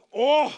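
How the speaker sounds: big, loud "oh" of hunger; loud groan. A narrator's reading. Hungry man at the gathering, loud groan. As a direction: groan it out loudly.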